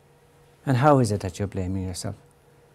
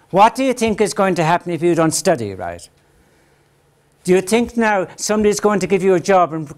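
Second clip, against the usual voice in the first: put-on loud, high, hammering questions at the child; scolding parent; 6 semitones higher, 5 dB louder than usual. Parent nagging a son about school, loud and scolding. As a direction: play the exasperated parent lecturing.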